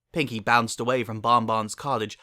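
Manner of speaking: usual speaking voice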